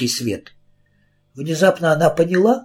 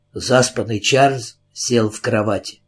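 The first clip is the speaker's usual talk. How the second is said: plain reading voice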